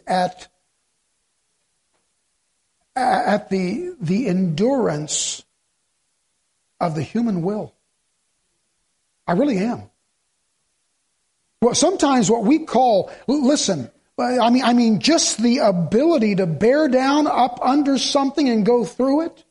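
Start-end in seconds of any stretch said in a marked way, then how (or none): none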